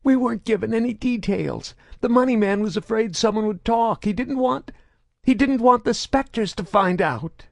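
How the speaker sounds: high, strained nervous voice; frightened stammering. A narrator's reading. Grotto nervously explains to his interrogator. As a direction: stammer out the details.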